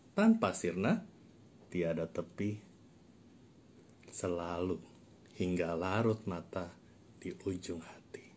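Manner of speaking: emphatic spoken-word poem; dramatic poetry recitation. Acted. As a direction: perform the poem theatrically, pausing between phrases. Recites second, more dramatic poem.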